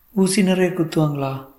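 put-on high-pitched little boy voice; child's question. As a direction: ask like a small worried child. Small son asks about injections. (சிறுவன் போல உயர்ந்த குரலில்)

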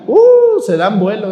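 normal talking voice

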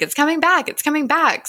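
high, eager imitation of a kid; lightly acted, high-pitched hope; retold excited cry. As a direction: call it out in mock excitement. Retold boy cheering at the return.

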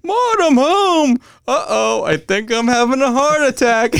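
high, slow, hammed-up cartoon voice; loud, high, slow cartoon husband impression; imitating Homer. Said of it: "He's like".

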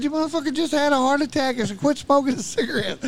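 high, loud retold telling-off; loud high-voiced reprimand; exasperated scolding. Acted scolding of smoking friend.

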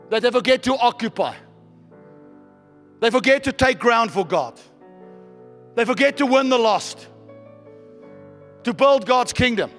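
ordinary delivery

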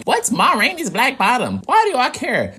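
high, puzzled mock question; confused listener voice. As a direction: play a clueless viewer asking. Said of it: "in case you like".

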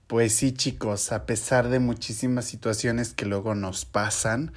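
normal talking voice

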